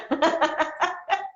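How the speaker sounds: bright rising laugh, high up. Real, not acted. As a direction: burst out laughing at your own tease. Laughing at her own teasing.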